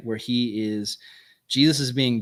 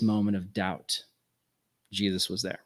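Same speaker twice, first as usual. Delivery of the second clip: lower, falling away softly on "there"; hushed low point; 4 semitones lower, 6 dB quieter than usual. Shown as a demonstration. Preacher recalling Peter's doubt, subdued and tender. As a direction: let "doubt" sink, then softly "Jesus was there".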